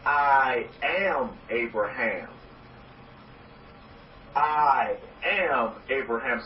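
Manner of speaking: slow, deliberate chant of I am Abraham; slow affirmation. Shown as a demonstration. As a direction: lean on am, drawn out like a creed. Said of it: "I want you to say this".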